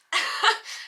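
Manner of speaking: sheepish unvoiced giggle; embarrassed little laugh; short breathy laugh. Real, not acted. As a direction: laugh it off, caught out. Caught out by mom.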